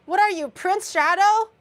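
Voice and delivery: loud mocking disbelief; incredulous teasing. Real, not acted. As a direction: scoff at him, loud and fast. Teasing friend over tomato.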